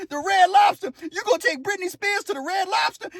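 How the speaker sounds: very high, mocking incredulity; laughing disbelief. Inmates tease Bo Luke about his date plan, high mocking disbelief. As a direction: squeal it in mock disbelief. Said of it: "We start laughing".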